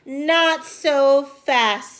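slow, loud, firm hold it; stern stop. A narrator's reading. Ruby stopping her brothers, slow and firm. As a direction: draw it out to stop them. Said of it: "said Ruby".

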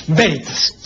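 "Veritas" as BÉ-ri-tas, a Spanish B with stress on the first syllable; traditional Spanish veritas. Shown as a demonstration. Teacher demonstrating the traditional reading to a radio listener. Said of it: "pronunciación tradicional española".

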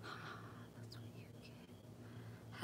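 slow, soft unvoiced whisper. Real, not acted. Sewer whispering slowly to herself. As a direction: breathe it out slowly to yourself.

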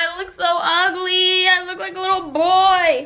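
high, loud crying whine; tearful whine. Real, not acted. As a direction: wail it through tears. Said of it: "I'm really sad about it".

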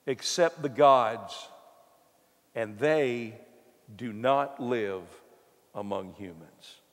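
dramatic scripture reading. Acted. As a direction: read it like a solemn proclamation to the king. Reads the astrologers' reply aloud.

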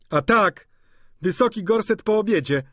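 loud, high sarcastic echo; mock the mistress; mocking maid. A narrator's reading. Maid Sylwia loudly mocking the corset after dinner.

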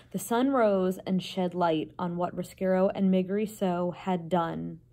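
ordinary delivery

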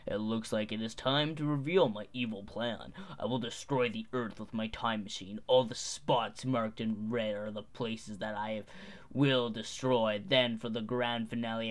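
gloating cartoon villain; evil villain voice. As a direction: boast it like a mad scientist. Villain monologue in game.

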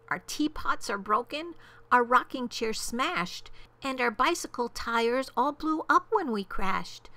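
high little voice listing woes; piping, aggrieved small official; distressed tiny mayor. A narrator's reading. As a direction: report disaster in a plaintive high pitch. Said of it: "came the voice of the mayor".

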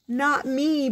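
high little-frog objection; bubbled protest. A narrator's reading. Froggy in the water to his mother, bubbled protest. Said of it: "bubbled Froggy".

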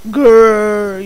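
loud growl like an angry zombie. Pretend.

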